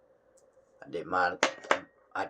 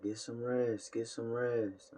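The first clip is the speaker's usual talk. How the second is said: viewers nagging; 6 semitones higher, slower than usual. Retold or imitated. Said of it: "I know y'all be like".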